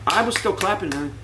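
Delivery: usual speaking voice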